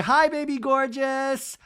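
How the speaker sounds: high, slow singsong catchphrase; gushing group greeting. Retold or imitated. Everybody greeting Lisa, high mimicked gushing. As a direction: coo the greeting high and stretched. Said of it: "And everybody's like".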